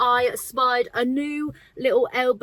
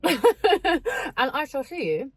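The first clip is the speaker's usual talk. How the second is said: ordinary talk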